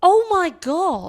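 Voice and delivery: high, slow, stunned exclamation; drawn-out shocked gasp; astonished. Real, not acted. Shocked at a player's age.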